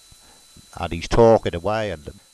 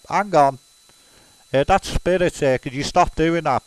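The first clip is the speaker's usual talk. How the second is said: a lightly raised, pushy spirit cutting in; a touch higher, mock spirit interruption; interrupting spirit; 6 semitones higher than usual. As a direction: butt in on the reading as a bossy spirit.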